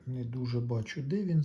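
ordinary talk, nothing marked

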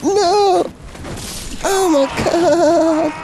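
yelled, high and dragged out; furious shriek at the game; raging gamer. Real, not acted. Dying to a boss, screaming.

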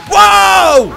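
high loud startled cry; startled shout. Real, not acted. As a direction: jump at the scare. Sudden scare.

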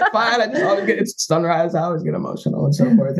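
lightly hammed-up brushing it off; mock casual cover-up. Hiding his nerves from her at sunrise, high and breezy. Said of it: "I'm like".

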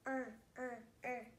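"Er" as a short r-coloured sound; phonics R sound. Shown as a demonstration. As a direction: sound out "Er, er, er" separately for the letter R.

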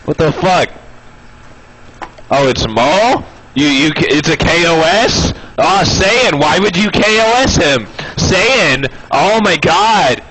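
high outraged whine. Real, not acted. Outraged at teammate's kill.

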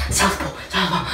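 breathy gasp. Real, not acted. Gasping in cold water.

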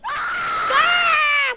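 loud, high yelp of alarm; startled scream. Real, not acted. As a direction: scream as if jumped from behind. Startled by attacker in game.

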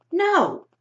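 high, loud sharp "No!"; sharp cry. A narrator's reading. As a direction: cut him off with one sharp shout. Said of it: "Her voice was so sharp".

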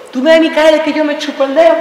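high, indignant comic challenge; hammed-up jealous outrage; angry accusation.

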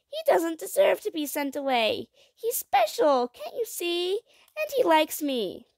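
put-on high-pitched, earnest child voice; high, urgent appeal; pleading child. A narrator's reading. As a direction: plead his case like a little girl.

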